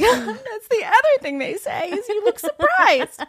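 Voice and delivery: high, whiny retelling; hammed-up high whine; mock high whine. Telling the director, in a mock high whine. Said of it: "I was like".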